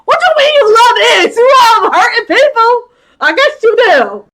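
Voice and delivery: loud, high-pitched disbelief; incredulous scolding. Real, not acted. Recapper scolding a man who loves hurting people, incredulous. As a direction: call him out, appalled.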